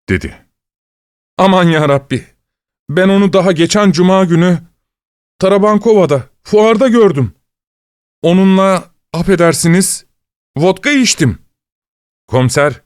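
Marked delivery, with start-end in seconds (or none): 1.4-11.4 higher-pitched, dismayed disbelief; astonished lament. A narrator's reading. Investigator recalling last Friday's drink with the victim, astonished. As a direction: recall it in raised, stunned tones. Investigator reacts, shocked at the news.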